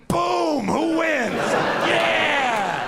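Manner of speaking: high, shouted triumph; hammed-up high victory whoop; victory cheer. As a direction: gloat like you just scored. Atheist celebrating victory.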